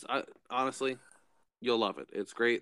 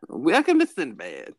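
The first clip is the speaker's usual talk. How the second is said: cheery shop greeting; 6 semitones higher, 7 dB louder, slower than usual. Pretend.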